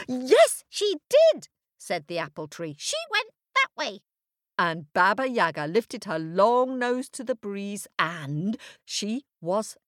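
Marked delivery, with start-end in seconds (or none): 0.1-4.0 high loud squeaky tree. A narrator's reading. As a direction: squeak it out quickly. Apple tree answers.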